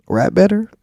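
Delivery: sarcastic jab, pitched high and loud; mocking question. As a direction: toss it off as a mocking dig. Mocking [name]'s rival.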